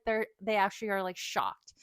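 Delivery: normal talking voice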